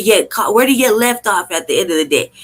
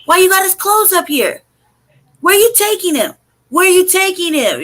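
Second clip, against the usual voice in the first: high-pitched protest yell, not full volume; pitched way up, indignant retold shouting; 9 semitones higher than usual. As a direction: reenact hollering demands at the officers. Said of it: "I'm yelling, like".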